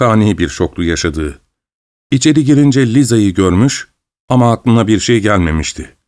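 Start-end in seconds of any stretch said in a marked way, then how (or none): none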